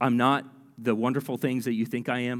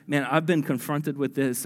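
ordinary delivery

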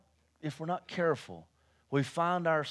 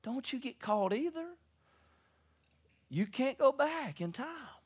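preaching; 10 semitones higher than usual. Acted.

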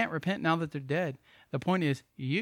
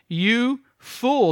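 stern rebuke; 6 semitones higher, 6 dB louder than usual. Acted. Jesus rebukes the rich fool.

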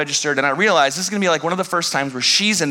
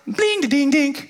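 error tone; 10 semitones higher than usual. Retold or imitated. Imitating phone call failing.

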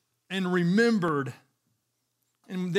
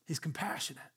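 much softer, almost under the breath; hushed; 10 dB quieter than usual. Acted. Preacher softly reassuring the listeners. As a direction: say it hushed and tender. Closing repeat of compassionate.

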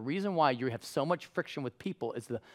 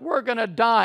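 high, loud mock wail; hammed-up despair; 10 semitones higher, 8 dB louder than usual. Thirsty Israelites complaining in the desert, hammed-up despair. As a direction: cry out as if doomed, overdone.

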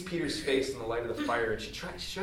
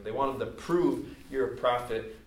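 ordinary talk

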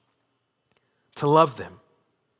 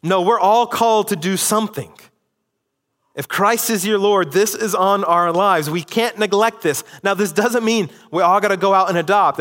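higher and louder, urgent insistent preaching; preaching at full force; 5 semitones higher, 3 dB louder than usual. Acted. Pastor urging the church that all must act, fervent preaching. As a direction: press the congregation, rising with conviction.

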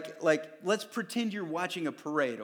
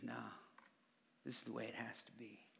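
soft firm Jesus; 7 semitones lower, 20 dB quieter, faster than usual. Acted. Jesus answers softly.

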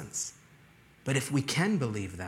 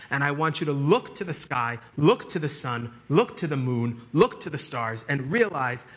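loud, punchy exhortation; forceful preaching; 3 semitones higher, 6 dB louder than usual. Real, not acted. Preacher urging congregation to get outside and look up, forceful preaching. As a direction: drive each command home with force.

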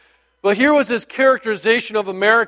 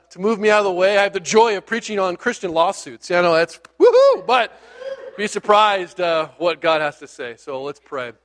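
ordinary talk, nothing marked

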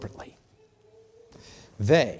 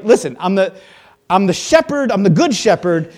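loud, higher, hammering it home; insistent shout; 5 semitones higher, 11 dB louder than usual. Acted. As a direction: demand they finally get it. Jesus insisting again.

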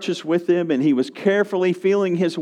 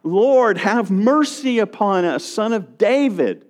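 raised, high pleading call; crying out; 5 semitones higher than usual. Retold or imitated. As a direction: plead aloud to be noticed. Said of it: "so they cried out".

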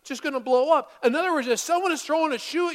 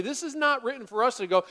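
ordinary talk, nothing marked

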